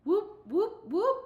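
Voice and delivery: high, loud rising whoops; siren-like whoops. Retold or imitated. Crowd whooping as Ponytail looks back, high and loud. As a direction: wail it like a siren. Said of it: "the whoops started back up like a siren".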